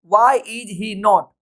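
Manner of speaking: very slow, word by word; slow teaching drill; slow dictation. Shown as a demonstration. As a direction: lay out the formula slowly. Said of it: "formula देखिए".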